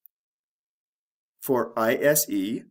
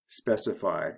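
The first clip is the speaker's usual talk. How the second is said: ordinary delivery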